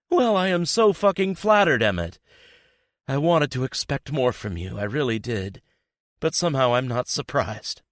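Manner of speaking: high, loud, bitter mock flattery; bitter sarcasm. A narrator's reading. Betrayed girlfriend scorning him, high bitter sarcasm. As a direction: spit out scornful disappointment. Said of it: "laugh fills the room, so bitter".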